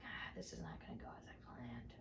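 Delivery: very soft, low whisper; hushed thought under the breath; muttered aside. Retold or imitated. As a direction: whisper a private realization. Private thought after his "No.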